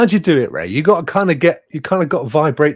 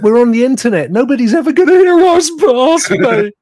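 loud, high-pitched mock lament; mock despair; 12 semitones higher, 7 dB louder than usual. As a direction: wail it in comic despair. Mock despair at having no audience.

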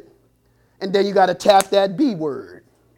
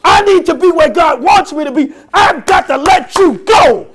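loud, high fervent preaching; preaching at full force; 6 semitones higher, 5 dB louder than usual. Real, not acted. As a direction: build the sermon up loud.

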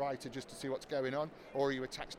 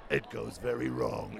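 trailer voice; 5 semitones lower, slower than usual. Acted. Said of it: "So I just practiced it".

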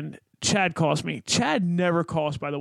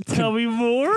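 eager; 6 semitones higher, 4 dB louder, slower than usual. Retold or imitated. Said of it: "I'm like".

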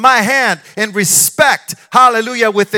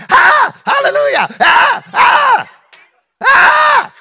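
shrieked much higher and louder, hammed-up; mock church screaming; 5 dB louder, slower than usual. Preacher mocking a noisy churchgoing sister, loud high shriek. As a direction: imitate a sister shouting hallelujah in the pew. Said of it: "every five minutes she's screaming".

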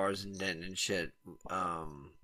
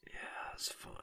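breathy near-silent whisper, much softer; whispered aside; 15 dB quieter than usual. Real, not acted. Whispering to his friend about the fun bar nights. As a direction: let it slip out under the breath, barely voiced.

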